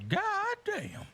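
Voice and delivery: very high, squeaky God damn; mimicking a friend's squeaky God damn; friend's odd God damn. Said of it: "how my friend used to say".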